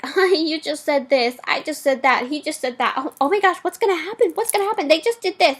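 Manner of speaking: high, excited mock of a nervous viewer; imitating anxious chatter. Mocking the girl who asks questions during the movie, high and fretful. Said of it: "She was like".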